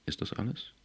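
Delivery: leise, knappe Nachfrage; kühl abfertigend, Stimme am Ende angehoben; kalt. A narrator's reading. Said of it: "erwiderte Foster kalt". (kalt nachgefragt)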